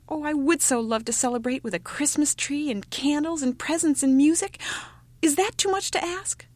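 put-on high, eager child voice; pleading youngest child. A narrator's reading. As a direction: coax mother with a breathless childish wish. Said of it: "cried, youngest child".

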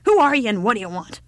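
put-on loud, demanding old woman; old woman shouting. A narrator's reading. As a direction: challenge a stranger like a deaf old woman. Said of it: "shouted the old woman".

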